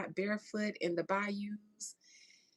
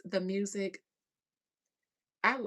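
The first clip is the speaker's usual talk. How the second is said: ordinary talk, nothing marked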